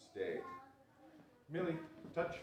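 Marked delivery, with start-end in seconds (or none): 1.5-2.4 high cue to the dog; dog command. Acted. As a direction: call the dog's cue brightly. Cueing the dog.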